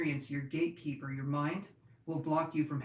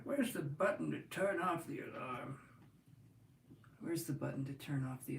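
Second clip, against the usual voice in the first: put-on old man voice; 5 dB quieter than usual. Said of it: "now I feel like an old man when I do this".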